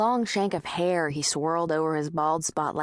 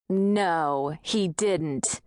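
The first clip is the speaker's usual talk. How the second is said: usual speaking voice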